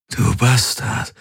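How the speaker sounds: worn-out man croaking in triumph; triumphant croak. Acted. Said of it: "krächzte er triumphierend".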